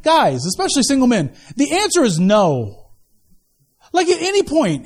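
ordinary talk, nothing marked

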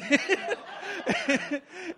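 a big, high laugh at his own mistake. Real, not acted. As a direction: burst of real laughter. Pastor laughs at himself.

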